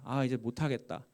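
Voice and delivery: softly voiced resignation; low-key, defeated aside; weary giving up. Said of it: "지치고 포기할 즈음이 됐습니다".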